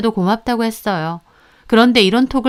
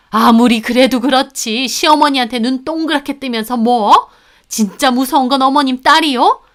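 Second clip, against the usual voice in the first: higher, affronted mother-in-law; outraged, raised-pitch rebuke; indignant scolding; 3 semitones higher, 4 dB louder than usual. Acted. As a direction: scold as if deeply insulted. Mother-in-law quarrels back indignantly.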